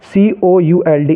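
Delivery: ordinary talk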